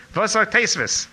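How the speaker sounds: loud, high-pitched question; imitated Yiddish demand. Rabbi quizzes a student in Yiddish, loud demand. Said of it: "He spoke in Yiddish those days".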